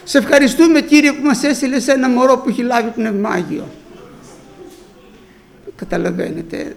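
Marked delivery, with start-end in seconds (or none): 0.0-3.7 loud, high praise to the Lord; loud joyful thanks. Retold or imitated. Grandmother thanking God for the young believer, loud shout. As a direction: cry out thanks to heaven. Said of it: "φώναζε εκείνη".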